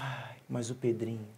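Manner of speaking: soft, low, slow sigh before the words; sighing grandmother. Retold or imitated. Grandmother introducing her grandson, sighing slowly. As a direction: imitate a resigned granny searching for something to say. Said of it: "ela dava aquela suspirada".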